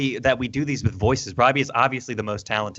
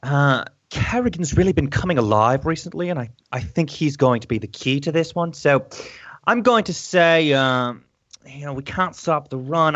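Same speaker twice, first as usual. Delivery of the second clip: deliberate educated British pundit; educated British accent. Acted. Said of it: "let's just keep going 'cause that's the best".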